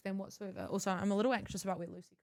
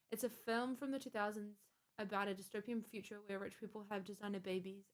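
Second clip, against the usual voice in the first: ordinary talk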